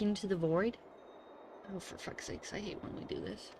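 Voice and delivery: normal talking voice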